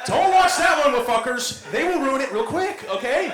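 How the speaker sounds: real laughing yell at the crowd; laughing shout. Real, not acted. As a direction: shout the warning through a laugh. Yelling warning at audience.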